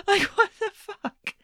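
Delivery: stunned, high-pitched outrage at the answers; incredulous. Real, not acted. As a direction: squeal it out in total disbelief. Reacting to absurd debate answers.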